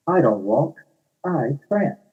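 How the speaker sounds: high, prissy unicorn; haughty unicorn. Acted. Unicorn corrects narrator, high haughty voice.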